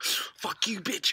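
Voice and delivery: high mock yell at cancer; defiant yell. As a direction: spit it out defiantly. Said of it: "and be like".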